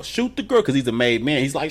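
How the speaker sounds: usual speaking voice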